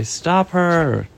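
high overlapping-style protests from storybook characters; lightly acted cries of alarm; crowd outcry. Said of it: "They say".

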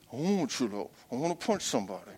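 low, soft, fast grumble; grumbling reply. Retold or imitated. Angry flesh answering the Holy Spirit, low mumble. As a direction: mutter it under your breath quickly. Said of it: "flesh answers the Spirit".

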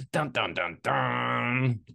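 ominous "dun dun dun" drumroll of dread; mock suspense sting. Host reveals alligator on the beach, mock suspense sting. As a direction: intone a dramatic cliffhanger sting.

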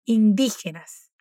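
indígenas, slow, with a rasping throat g; Spanish G sound. Shown as a demonstration. Profesora: indígenas lento con la ge raspada. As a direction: draw out indígenas and scrape the g. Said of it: "tiene la misma pronunciación de la R de inicio".

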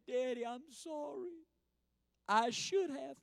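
put-on high, soft child's apology; small, sheepish little-girl voice; sorry little girl. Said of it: "She says".

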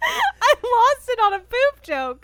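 can barely talk for laughing; helpless laughter. Real, not acted. Co-host admits she lost it on a joke, laughing. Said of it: "We just lost [name]".

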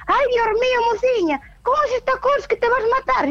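high, fussing worried voice; worried old man. Retold or imitated. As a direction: voice a fretting older man. Retold old man scolding.

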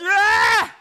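the high priest tearing his clothes; outraged scream. Acted. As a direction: scream as the robe tears.

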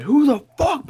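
high, loud mock outburst; retold scream. Teller acts out the furious guy with ketchup-hit shoes, retold scream. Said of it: "oh, he was screaming".

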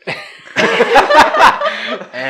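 loud high burst of laughter; real laugh at her friend's crying photo; big laugh. Real, not acted. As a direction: crack up loud. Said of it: "laughing at the photo".

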